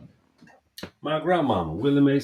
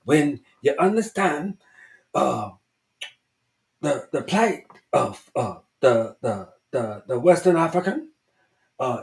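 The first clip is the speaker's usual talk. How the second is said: imitating Van Sertima; 6 semitones higher than usual. Narrator imitates Van Sertima to his viewers, higher and halting. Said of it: "he talks like this".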